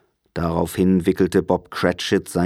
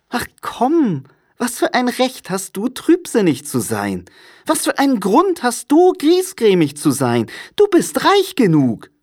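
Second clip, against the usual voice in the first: very high, lively comeback; jolly, high-pitched young relative; cheerful nephew, teasing; 3 dB louder than usual. A narrator's reading. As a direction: playfully turn the reproach round. Nephew's voice in the story.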